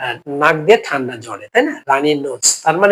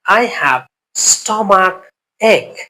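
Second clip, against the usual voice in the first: slow model phrase; 3 semitones higher, much slower than usual. Shown as a demonstration. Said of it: "teacher models English phrase".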